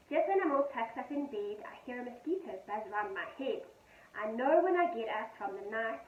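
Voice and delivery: gentle sing-song poem reading; poem read to children. A narrator's reading. Teacher reading a poem to her pupils, gentle and sing-song.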